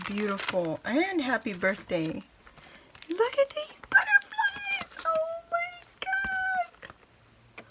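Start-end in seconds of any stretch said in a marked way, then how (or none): 3.1-7.7 very high, slow squeal of delight. Real, not acted. Seeing the handmade butterflies, squealing high.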